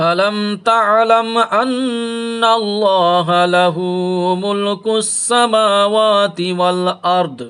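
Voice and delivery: Quran recitation. Acted. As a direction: chant the verse in a drawn-out tajweed melody. Recites the verse. (ধীর সুরেলা তিলাওয়াত)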